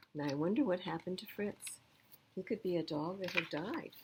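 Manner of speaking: ordinary delivery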